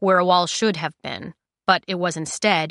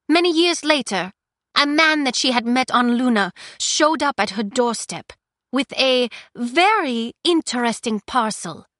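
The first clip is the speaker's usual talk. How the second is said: put-on higher, louder defiant young woman; defiant prisoner; 6 semitones higher, 4 dB louder than usual. Prisoner confronting the Queen, raised defiant voice.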